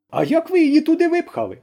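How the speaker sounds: a hint of a high questioning voice; lightly voiced officer asking; Paichadze asking. A narrator's reading. Officer asks the daughters, lightly voiced question. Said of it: "спитав Пайчадзе".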